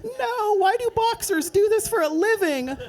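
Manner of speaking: a high, startled wail of disbelief; a high-pitched yelp, as if mid-fall; high panicked inner voice. Retold or imitated. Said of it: "I was like".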